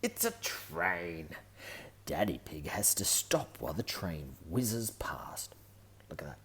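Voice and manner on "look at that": ordinary delivery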